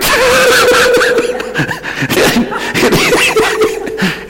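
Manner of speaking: long, very high laughing wail; helpless laugh. Real, not acted. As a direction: crack up until breathless. Cracking up at own thought.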